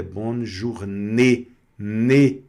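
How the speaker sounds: "journée" ending in a closed "ê"; slow "bonne journée", last vowel closed like "nê"; closed final e. Shown as a demonstration. Teacher models the French ending for students, closed e in "journée". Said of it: "você vai pronunciar fechado".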